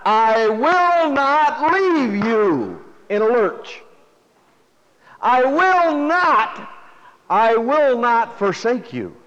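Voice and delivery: "I will not" slow, loud and high, each word hammered; emphatic repetition. Shown as a demonstration. Preacher voicing God's promise, slow emphatic stress. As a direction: pound out every "I will not". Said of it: "God is being very emphatic about it".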